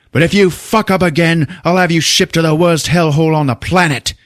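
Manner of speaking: high-pitched angry ultimatum; threatening chief. Retold or imitated. Chief warning Stone not to mess up, angry. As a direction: imitate a police chief blowing up.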